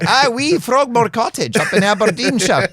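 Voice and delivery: hammed-up Scottish laird, high and loud through laughter; laughing mock Scottish accent. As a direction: lay the Scottish on thick, laughing. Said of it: "Scottish accent".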